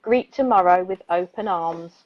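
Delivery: calm, measured poetry reading; poem reading. Acted. Radio host reads a soothing bedtime poem, calm poetry voice. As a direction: read it gently like a bedtime poem.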